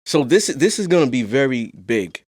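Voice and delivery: ordinary talk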